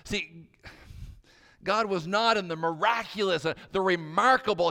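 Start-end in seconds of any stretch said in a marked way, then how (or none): 1.6-4.7 loud, higher, forceful preaching; emphatic preaching. Real, not acted. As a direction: drive the point home loudly. Preacher contrasting spectacle with whisper.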